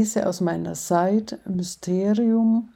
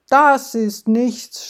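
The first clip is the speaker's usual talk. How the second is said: louder and slow, a put-down; snapping; 5 semitones higher, 7 dB louder, slower than usual. Acted. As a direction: snap back to top the boast. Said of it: "schnappt Mabel".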